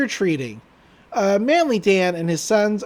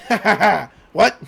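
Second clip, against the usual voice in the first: loud spooky ha ha ha, then puzzled; hammed-up laugh cut short by confusion; mock villain laugh; 6 dB louder than usual. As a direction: play the evil laugh, then deflate. Stan's failed scare.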